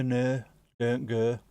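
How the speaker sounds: usual speaking voice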